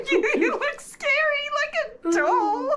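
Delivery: high squealing laughter through the words; laughing. Real, not acted. Teasing her partner about scary doll makeup, laughing high.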